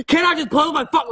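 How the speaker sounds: high-pitched shrieking version of the line; mock yell. Shown as a demonstration. Toddler to preschool teacher, the wrong way: high mock yell. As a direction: yell "Can't I just play with my fuck?" way up high, mock tantrum. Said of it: "Like, I don't fucking yell".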